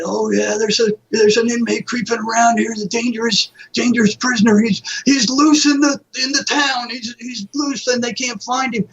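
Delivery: high, mock-frightened gossip voice; mock scared townsfolk. Townspeople gossiping about an escaped inmate, mock frightened. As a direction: imitate nervous locals spreading a rumor. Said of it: "everybody's scared".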